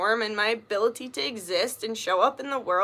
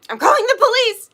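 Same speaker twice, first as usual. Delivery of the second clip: much higher and louder, hammed-up alarm; mock outrage; 11 semitones higher, 8 dB louder than usual. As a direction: fake an emergency call for laughs. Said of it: "Just kidding".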